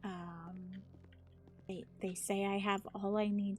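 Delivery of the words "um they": ordinary delivery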